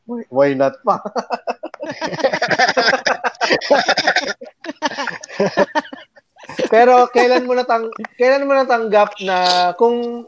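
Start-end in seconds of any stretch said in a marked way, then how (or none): 1.0-5.9 long, high-pitched burst of laughter. Real, not acted. Host cracking up after echoing "why not", long high laugh.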